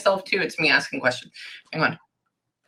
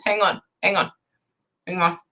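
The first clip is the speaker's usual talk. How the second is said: ordinary delivery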